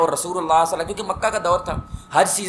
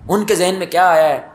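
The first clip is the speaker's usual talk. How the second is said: normal talking voice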